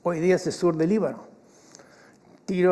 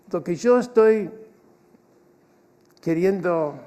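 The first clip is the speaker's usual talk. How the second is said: normal talking voice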